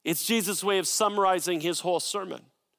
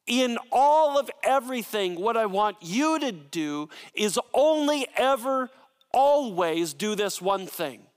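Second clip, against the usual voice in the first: all and always leaned on hard, higher; emphatic Jesus paraphrase; 4 semitones higher than usual. Acted. Preacher voicing Jesus to the church, emphatic. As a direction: press every all and always. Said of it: "the word you is emphatic".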